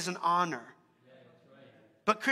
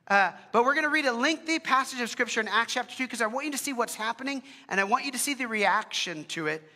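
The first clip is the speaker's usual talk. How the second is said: ordinary talk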